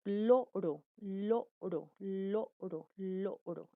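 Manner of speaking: "loro" slowly modelled, the "l" held clearly; lateral demonstration. Shown as a demonstration. Teacher showing correct tongue position, deliberate demonstration of "loro". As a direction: repeat "loro" as separate, careful model words. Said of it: "pronunciar la palabra y que salga correctamente".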